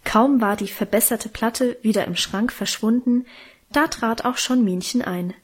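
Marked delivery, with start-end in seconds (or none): none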